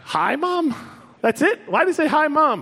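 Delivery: mock yourself in a high, incredulous voice; mocking disbelief. Mocking his own TV greeting with high disbelief.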